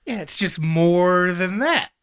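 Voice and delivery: drawn out, rising at the end; slow understatement. Acted. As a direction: stretch it hesitantly, holding back the laugh. Retries the same line straight.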